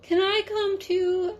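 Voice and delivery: high, small mouse; little mouse begging to come along; Mouse's voice. A narrator's reading. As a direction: ask it in a tiny high voice. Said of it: "asked Mouse".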